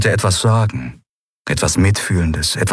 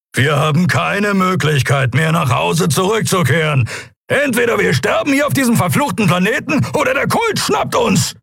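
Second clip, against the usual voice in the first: high-pitched shouting, building; furious, strained yelling; booming angry rant; 12 semitones higher than usual. A narrator's reading. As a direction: rage at a hopeless situation. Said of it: "Keros Worte in ihren Ohren dröhnen".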